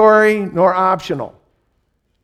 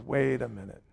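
puzzled aside; 5 semitones lower, 10 dB quieter than usual. Retold or imitated. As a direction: mutter it, baffled and suspicious.

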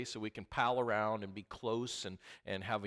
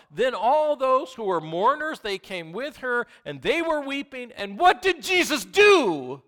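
preaching at full force; 12 semitones higher, 12 dB louder than usual. Acted. Preaching builds to climax.

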